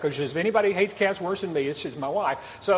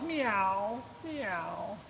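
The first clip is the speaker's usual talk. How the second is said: much softer, fading cat meows; faint meowing; 10 dB quieter than usual. Retold or imitated. Cat still hiding in the engine block, soft faint meows. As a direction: mew faintly like a cat far inside the engine. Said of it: "Still up in the engine block going".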